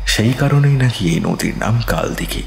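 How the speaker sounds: usual speaking voice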